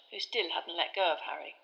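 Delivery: ordinary delivery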